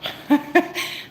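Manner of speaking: a quick, airy, high-pitched laugh; embarrassed high laugh. Real, not acted. Laughing at her embarrassing moment.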